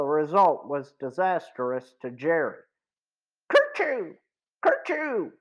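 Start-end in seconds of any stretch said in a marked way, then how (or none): 3.5-5.3 two high, sharp sneezes; loud sneezes. Acted. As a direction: sneeze twice, helpless. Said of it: "The sounds echoed off the bluffs".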